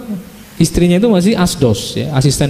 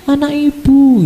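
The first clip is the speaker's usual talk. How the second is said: put-on child's voice; 10 semitones higher, slower than usual. Child answering mother.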